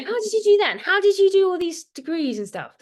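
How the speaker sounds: high, loud imitation of amazed people; incredulous questioning. People always asking the speaker how, high mock amazement.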